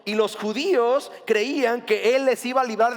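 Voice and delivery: ordinary delivery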